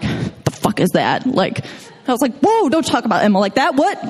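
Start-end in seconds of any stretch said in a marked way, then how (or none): 0.0-1.3 gruff ranger. Retold or imitated. Ranger's blunt reaction, quoted. 2.4-4.1 mock offended.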